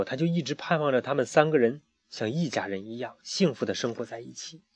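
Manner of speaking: ordinary delivery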